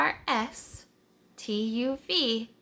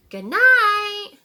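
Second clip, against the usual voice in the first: loud, much higher call; loud high child's shout; yelled good night; 10 semitones higher, 10 dB louder than usual. Acted. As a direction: call out to the animals across the yard. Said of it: "He yelled an extra loud".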